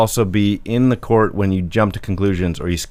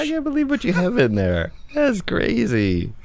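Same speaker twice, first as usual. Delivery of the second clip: mock shock; 3 dB quieter than usual. Mock shocked at pouch contents.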